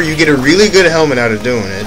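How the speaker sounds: usual speaking voice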